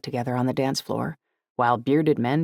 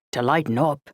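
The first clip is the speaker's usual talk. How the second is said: playful mock Irish lilt; amateur Irish brogue, teasing; put-on Irish accent. A narrator's reading. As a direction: finish it in your best Irish accent. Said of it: "I put on my best Irish accent".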